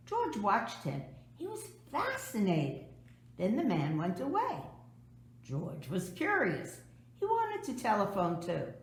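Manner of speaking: ordinary delivery, nothing marked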